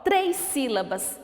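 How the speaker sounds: ordinary talk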